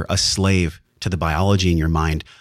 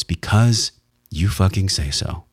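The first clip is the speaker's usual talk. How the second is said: "because you fucking say so" slowly, word by word; deliberate weight on every word of "because you fucking say so"; emphatic; slower than usual. Shown as a demonstration. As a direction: stretch "because", then land firmly on "fucking say so". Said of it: "important to put emphasis on words".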